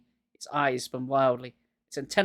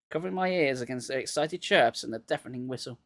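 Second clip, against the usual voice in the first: ordinary delivery, nothing marked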